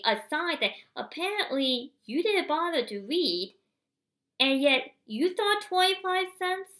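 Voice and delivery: rising, loud outraged sarcasm; indignant rant. Real, not acted. Venting at the man who gave him a quarter, furious and loud.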